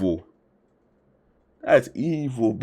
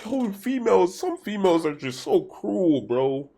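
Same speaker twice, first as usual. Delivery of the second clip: ordinary talk, nothing marked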